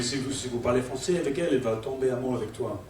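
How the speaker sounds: low, soft French sweet talk; seductive French. Acted. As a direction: woo her quietly in French. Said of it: "speak a foreign language".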